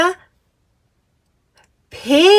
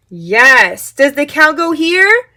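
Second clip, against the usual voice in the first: ordinary delivery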